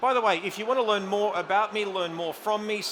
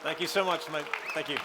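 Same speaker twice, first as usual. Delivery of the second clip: ordinary talk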